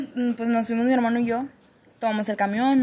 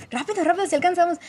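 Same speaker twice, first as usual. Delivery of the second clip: mock hurry; 7 semitones higher, faster than usual. Retold urging brother to run.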